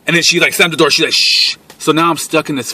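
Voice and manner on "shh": long, sharp shushing; urgent hush. Retold or imitated. Girl to lover as grandfather wakes, urgent hush. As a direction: imitate someone hushing you urgently. Girl hushes him at the door.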